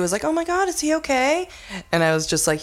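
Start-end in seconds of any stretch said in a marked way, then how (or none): 0.2-1.5 worried lady. Retold or imitated. Lady panicking on the plane.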